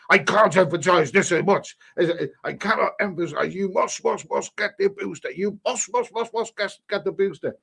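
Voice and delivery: a hint of Boris pleading about boosters; Boris Johnson impression. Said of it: "can I do a Boris impression".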